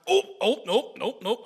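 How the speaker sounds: high, loud little yelps; acted-out quick alarm as it slips away; startled near miss. Angler losing a fish on the line, startled.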